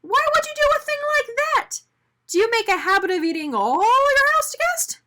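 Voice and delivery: high, loud indignant demand; outraged. A narrator's reading. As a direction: accuse him fiercely. Arnie confronts Mister Bing.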